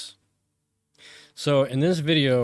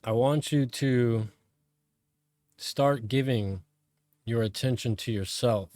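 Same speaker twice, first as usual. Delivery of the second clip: calm, measured meditation voice with long pauses; guided meditation; 3 semitones lower, 3 dB quieter than usual. Real, not acted. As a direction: guide them inward, phrase by phrase, letting each one settle.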